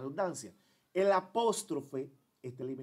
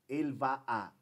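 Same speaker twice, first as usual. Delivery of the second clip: ordinary talk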